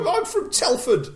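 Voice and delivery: very high, loud squeaky impression; mock Jordan voice. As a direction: pipe it up like a silly cartoon Jordan. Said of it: "Telford's got a lovely bridge".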